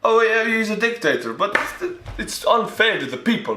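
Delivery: high, whiny-style mock protest; mock indignation. Viewer protesting to a dictator for the people, mock indignation. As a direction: splutter in exaggerated disbelief.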